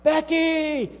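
high, loud call across the rink. Retold or imitated. Mother calling her daughter across the ice rink, loud high call. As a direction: call a child's name over a crowd. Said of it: "and she calls out".

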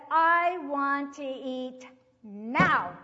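very loud, slow, every word stretched; insistent demand. Retold or imitated. Hungry boy insisting to his teacher, loud drawn-out demand. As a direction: imitate a child demanding, hammering a long "now".

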